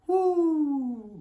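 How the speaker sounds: high, loud, drawn-out whoo; mock whoop. Son telling of mom's reaction, loud high whoop. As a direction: let out a rising-alarm whoo.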